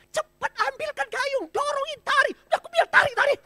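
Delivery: very high, loud frantic orders; panicked yelling. Acted. Disciples yell frantic orders in the storm, high and loud. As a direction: shout like sailors in a storm. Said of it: "saking paniknya".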